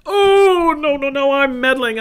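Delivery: sudden high squeal of mock alarm. Real, not acted. Preacher catching himself meddling, squealing in playful alarm. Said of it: "They call me the meddler".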